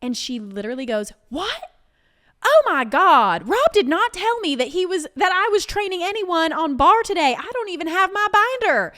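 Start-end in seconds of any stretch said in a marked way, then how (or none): 1.3-8.9 high, loud panicked rush; frazzled bartender caught off guard; flustered outburst. Retold or imitated. Bartender Amanda panicking at a surprise trainee, loud and high.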